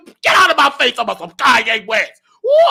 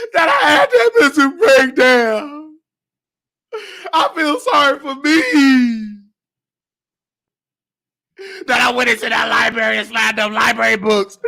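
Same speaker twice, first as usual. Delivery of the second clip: mock wailing; 3 semitones higher than usual. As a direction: wail it out like a hammed-up sob. Wails self-pity in a comic rant.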